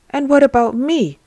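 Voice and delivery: put-on a left-out old man demanding his share; indignant outburst. Old beggar protesting to Jesus, indignant and loud.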